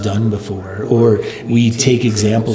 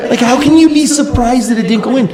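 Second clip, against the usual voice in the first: much higher, louder, incredulous demand; exasperated retort; 11 semitones higher, 4 dB louder than usual. Retold or imitated. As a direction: let the disbelief burst out high and loud. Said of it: "I finally said".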